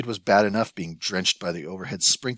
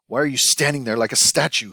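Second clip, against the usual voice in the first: higher, louder scolding at his son; shouted; 5 semitones higher, 6 dB louder than usual. Acted. Hu shouts at his son during the kitchen fire. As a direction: snap it at the boy in alarm. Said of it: "Hu shouted at his son".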